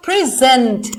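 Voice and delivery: "Present" with the weight on gent; stress on second syllable. Shown as a demonstration. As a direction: hit gent hard in "present". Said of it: "मैंने gent पे जोर लगाया".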